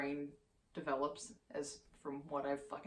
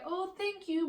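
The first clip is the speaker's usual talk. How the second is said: much higher sing-song praise for a puppy; praising the dog; 13 semitones higher, 4 dB louder than usual. Shown as a demonstration. Owner thanking her jumping dog, high cooing praise. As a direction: coo it to the dog like baby talk. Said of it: "you can grab his paws and say".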